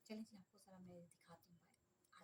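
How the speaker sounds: hushed whisper to the camera. Real, not acted. Mother slipping outside with the camera to show the shoes, whispering. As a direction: whisper so the daughters won't hear.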